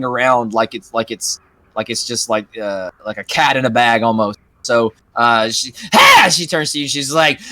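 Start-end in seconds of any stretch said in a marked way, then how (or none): 5.9-6.3 witch scream. Acted. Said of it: "kind of screams".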